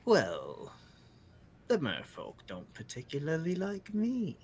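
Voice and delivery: coy and superior, trailing into softness; haughty bird creature. Acted. Bird explains the merfolk's dislike, coy haughty creature. Game master voices the bird.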